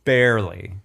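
ordinary delivery

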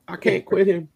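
high, hammy movie-line delivery; mock high quote. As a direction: say it like a melodramatic film line. Quotes Brokeback line jokingly.

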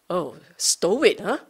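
ordinary delivery

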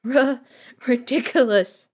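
loud, high, stammered cry; acted tearful exclamation; sobbed. A narrator's reading. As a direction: stumble on the first sound, then cry out. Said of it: "she sobbed again".

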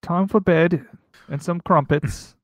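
badly faked English accent, a bit drawn out. Echoing co-host's bedtime line, bad English accent. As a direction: attempt an English accent and miss. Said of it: "the worst English accent I've ever done".

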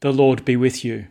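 solemn intoned greeting; liturgical greeting. Acted.

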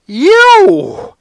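very high, loud yelp of pain. A narrator's reading. Narrator was just bitten hard by Brianna, yelping in pain. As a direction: yelp like a wounded animal. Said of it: "yelped in pain like a wounded animal".

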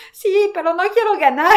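high, loud mock whine of a scared contestant; imitating nervous friend. Retelling to viewers the friend's backstage panic, high pleading whine. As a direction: play the friend who dreads winning, pleading.

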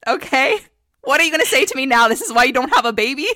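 rising mock rant at a future husband; sassy loud telling-off. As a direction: put him in his place, loud and sassy. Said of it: "I'm gonna look at them and say".

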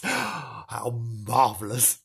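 soft, slow delighted gasp; gasping wonder. A narrator's reading. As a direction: breathe it in wonder.

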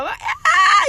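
loud, high mock shriek; retold scream. Friend acts out her embarrassed reaction, high loud scream. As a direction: shriek in laughing disbelief. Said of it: "Jag bara skriker rakt ut".

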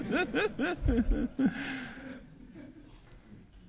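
long laugh falling away; hearty laugh. Real, not acted. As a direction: laugh long at your own joke. After punchline.

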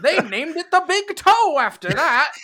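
put-on booming, high old newsreel narrator; old radio announcer. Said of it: "I like your newsreel voice".

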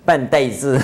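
breaking into a chuckle around 办代志; amused laughing. Real, not acted. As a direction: chuckle through the words. Amused by own Taiwanese wordplay.

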